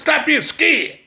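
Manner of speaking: ordinary talk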